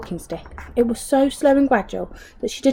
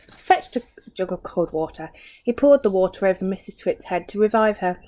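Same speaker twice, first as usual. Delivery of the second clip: ordinary delivery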